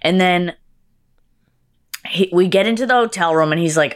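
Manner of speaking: ordinary talk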